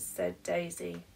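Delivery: usual speaking voice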